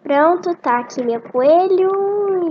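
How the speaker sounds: proud reveal. Real, not acted. Girl showing viewers her new rabbit, proud and loud.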